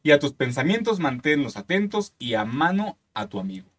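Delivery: slow, solemn reading of lyrics; dramatic lyric reading; poem recital. Acted. Reading trova lyrics aloud.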